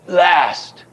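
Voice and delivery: "last" punched high and falling; head accent. Shown as a demonstration. Teacher isolating the second head accent, stress on "last". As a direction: hit "last" hard from the top. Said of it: "two head accents".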